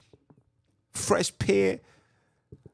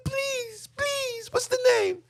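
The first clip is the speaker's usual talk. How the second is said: very high falsetto begging; pleading woman; slower than usual. Retold or imitated. Terrified mother begging the captor, high falsetto. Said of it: "She said".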